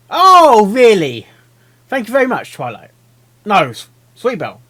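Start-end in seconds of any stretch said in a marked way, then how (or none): 0.1-1.3 loud, high "oh, really?"; exasperated. Real, not acted. Reader answers the wailing filly, loud exasperation. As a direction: fire it back, loud and high. Reacts to the character's protest.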